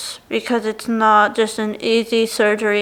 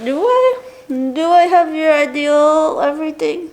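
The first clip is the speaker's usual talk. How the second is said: surprised; 5 semitones higher than usual. Real, not acted. Flattered by viewer's compliment.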